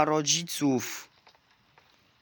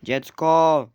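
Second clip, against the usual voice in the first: calling out; 3 semitones higher, 12 dB louder than usual. Real, not acted. Calling baby loudly.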